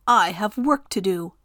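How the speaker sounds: ordinary delivery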